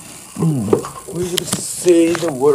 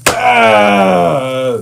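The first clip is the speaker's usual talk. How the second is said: loud victory shout; 8 dB louder than usual. Pretend. Victory cry after the fight.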